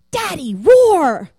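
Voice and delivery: put-on slow, stretched, high and loud child's call; a four-year-old begging her daddy to roar, drawn out and loud; little girl's plea.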